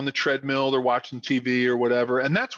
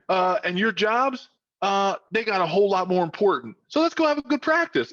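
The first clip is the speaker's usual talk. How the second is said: higher-pitched Southern coach imitation; attempted Southern accent; 7 semitones higher than usual. Coach warning players their jobs matter more, imitated Southern accent. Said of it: "tried to do my Southern accent".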